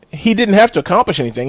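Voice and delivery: ordinary delivery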